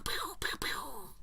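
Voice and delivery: high squeaky pew noises; laser pew sounds. Shown as a demonstration. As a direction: make the laser gun noises. Imitating laser shots.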